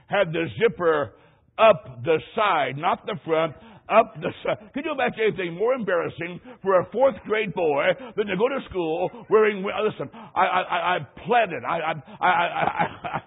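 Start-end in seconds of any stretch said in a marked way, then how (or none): none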